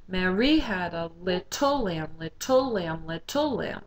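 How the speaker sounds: "Mary" as ma-RY, "little" as lit-TLE; spoken, punching the last syllable of "Mary" and "little"; stress on second syllables. Shown as a demonstration. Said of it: "Try to emphasize the second syllable".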